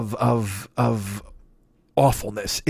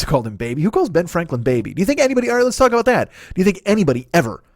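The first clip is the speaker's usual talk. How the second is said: usual speaking voice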